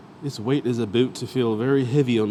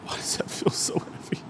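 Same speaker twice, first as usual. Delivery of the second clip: winded whisper after the lift; breathless whisper; 7 dB quieter than usual. Real, not acted. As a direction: gasp it out, spent. Exhausted after heavy set.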